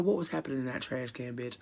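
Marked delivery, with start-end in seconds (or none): none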